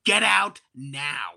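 slow, cold telling-off; mock stern get out; stern dismissal. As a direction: order him out, drawn out and deliberate. Fantasy telling off bathroom attendant.

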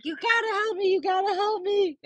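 high, whiny put-on sobbing plea; fake-cry to guilt the boys into helping; imitating a staged crying plea, pitched high. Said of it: "It's actually a fake cry".

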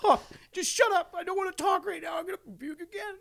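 high, fading mock argument; half arguing. Sick player half arguing with the referee, fading out.

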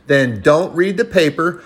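ordinary delivery

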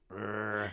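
acted-out "grr" of frustration; frustrated storyteller's growl; exasperated growl. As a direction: growl in mock irritation. Retold exasperated reaction.

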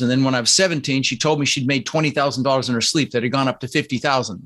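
normal talking voice

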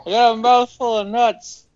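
imitating someone with a mouth full; mock mouthful voice. Shown as a demonstration. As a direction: mimic talking through nuts. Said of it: "Good thing I got that on tape".

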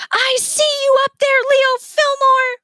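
high, loud shrieking villainess; screamed. A narrator's reading. Villainess screaming up at the boy on the roof. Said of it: "Miss Sparks screamed".

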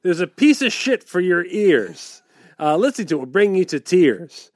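sing-song limerick recital; bouncy limerick lilt. Pretend. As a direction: bounce through the rhyme. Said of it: "would like a limerick".

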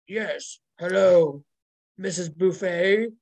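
low, gravelly mystery caller; Joey's gravelly voice. Retold or imitated. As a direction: put on a low, gruff phone voice. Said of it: "really low, gravelly voice going on".

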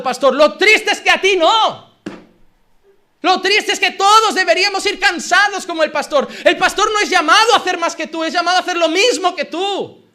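loud, driving sermon delivery; rousing the church to serve; fiery preaching. Acted. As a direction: preach it out with full force. Preaching climax to congregation.

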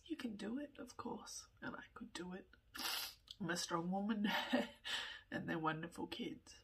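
ordinary delivery

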